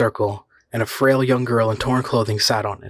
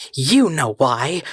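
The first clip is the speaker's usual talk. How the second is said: very slow, high accusation; accusing; 4 semitones higher, much slower than usual. A narrator's reading. The girl accusing the man, slow and bitter. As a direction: drag it out, accusing him. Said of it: "she accused".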